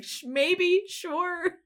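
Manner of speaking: very high, whimpering reluctance; meek squeak. Retold or imitated. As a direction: squeak out a scared yes. Retold timid reluctant answer.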